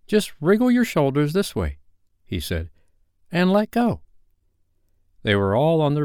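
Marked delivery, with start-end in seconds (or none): 0.1-1.8 put-on high, encouraging boy voice; eager young instructor, pitched up; boy instructing. A narrator's reading. Peter instructs the children in flying. 3.3-4.0 put-on high, urging boy voice; child's high call to launch; boy instructing. A narrator's reading. Peter instructs the children in flying.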